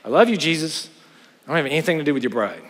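mocking the hypocrite's brush-off, rushed and dismissive; dismissive churchgoer. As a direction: toss it off like a smug hypocrite.

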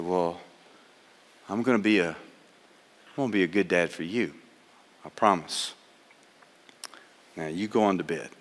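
quiet, moved reassurance at the bedside; tender father. Retold or imitated. Father soothes his new son at bedtime, tender and hushed.

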